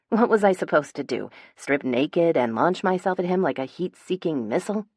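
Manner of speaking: ordinary delivery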